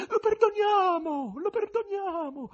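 very high, drawn-out falling cries; echoing female voices. A narrator's reading. Women in the audience answer, very high falling cries. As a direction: call perdoniamo in a high feminine wail, falling at the end. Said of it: "echeggiarono delle voci, dapprima isolate e in prevalenza femminili".

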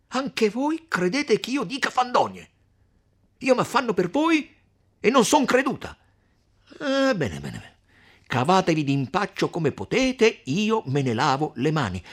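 high, loud, offended outburst; piqued mother, voice shooting up; huffy indignation. A narrator's reading. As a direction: protest loudly, then wash your hands of it. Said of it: "il piccolo sdegno d'Agnese".

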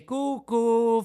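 high sing-song cucù teasing; cheeky puppet jeering cucù; mocking cuckoo. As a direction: taunt like a cuckoo clock. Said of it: "in segno di canzonatura".

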